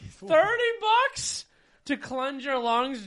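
slow, high, laughing through the words; laughing disbelief. Real, not acted. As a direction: laugh it out slowly. Laughing at friend's spending.

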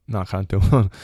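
usual speaking voice

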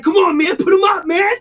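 high, loud shout of a guy itching to fight; mock fight challenge. The guy outside Lids challenging him to fight, shouting high. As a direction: bark it like a tough guy squaring up.

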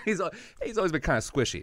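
high, laughing through the words. Real, not acted. Repeating mom's odd word to the host, laughing hard. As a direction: crack up while repeating it.

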